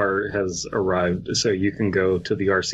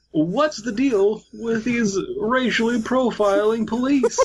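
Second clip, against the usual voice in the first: Seinfeld-style comic; 11 semitones higher than usual. Pretend. Jerry Seinfeld impression.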